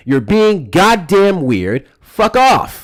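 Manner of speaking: slow, loud, high furious yell; angry shout. Real, not acted. Host cutting off a weird caller, shouting. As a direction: drag it out, then blast him off.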